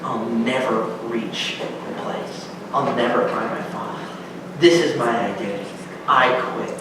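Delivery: low, soft, defeated resignation; giving up. Acted. Fievel about to give up on finding his father, low and soft. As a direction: let the hope drain out and quit. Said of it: "he's just now going".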